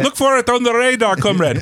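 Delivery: hammed-up Russian radar officer; high mock Russian accent. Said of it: "first poor Russian accent for the evening".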